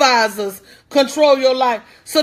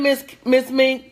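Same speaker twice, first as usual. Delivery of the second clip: normal talking voice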